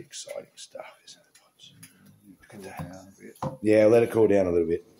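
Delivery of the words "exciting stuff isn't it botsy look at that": soft unvoiced whisper to the dog; hushed whisper. Real, not acted. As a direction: whisper so the dog stays calm.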